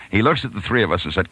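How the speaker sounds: ordinary talk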